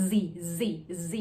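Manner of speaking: "Z" with teeth together and a buzzing z sound. Shown as a demonstration. Teacher demonstrating the "Z" buzz to learners. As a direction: buzz the "Z" through closed teeth. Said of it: "แล้วก็ออกเสียง".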